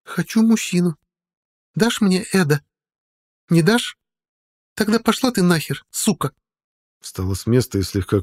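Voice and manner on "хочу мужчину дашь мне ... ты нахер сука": sober, clear voice. A narrator's reading. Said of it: "совершенно трезвым, ясным голосом".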